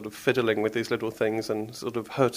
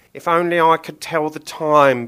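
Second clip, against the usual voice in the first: higher, louder dim butcher's voice; Billy the butcher; 5 semitones higher, 6 dB louder than usual. Acted. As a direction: play the thick butcher musing. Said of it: "Billy thought".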